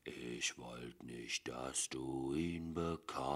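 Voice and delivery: slow, breathless whisper; eerie whisper. A narrator's reading. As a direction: whisper it slowly, like humming a tune. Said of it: "summte er".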